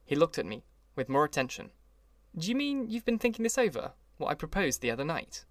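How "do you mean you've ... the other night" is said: lightly voiced Gatsby, starting higher; eager Gatsby. A narrator's reading. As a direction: ask it with eager hope. Gatsby asks narrator eagerly.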